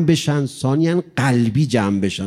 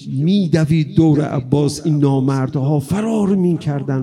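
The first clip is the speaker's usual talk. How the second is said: usual speaking voice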